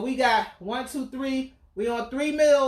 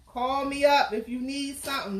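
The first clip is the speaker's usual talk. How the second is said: usual speaking voice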